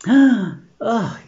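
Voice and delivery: high, loud, distraught "oh" after a gasp. Acted. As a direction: cry out in mock dismay for a stricken friend. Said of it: "absolutely distraught".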